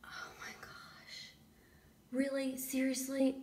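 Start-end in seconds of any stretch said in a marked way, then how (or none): 0.1-1.3 slow breathless oh my gosh with a gasp; stunned whisper. Real, not acted. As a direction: gasp in awe, then whisper.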